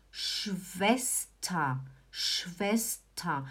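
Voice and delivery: soft, low "Schwester" with long sh and final "ta"; correct SH onset. Shown as a demonstration. Teacher correcting learners, "Schwester" opening on a long shush. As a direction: draw out "Schwester" as SHVES-ta. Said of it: "Não é Svesta, é sh".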